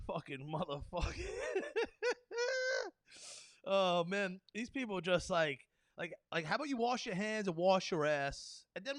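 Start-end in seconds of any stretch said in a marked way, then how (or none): 1.2-2.9 a high burst of laughter; high delighted laugh. Real, not acted. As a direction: cackle at your own joke. Laugh after the joke.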